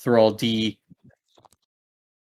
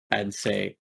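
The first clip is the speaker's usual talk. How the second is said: ordinary talk, nothing marked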